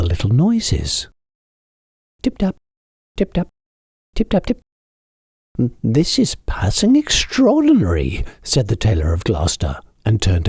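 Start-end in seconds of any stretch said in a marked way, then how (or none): 2.2-4.6 high, light tapping sounds; little mice tapping on the dresser; tiny tapping. A narrator's reading. 5.8-8.2 high, wondering exclamation; the tailor astonished by a mouse under a teacup; amazed old tailor. A narrator's reading.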